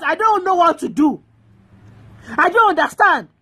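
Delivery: ordinary talk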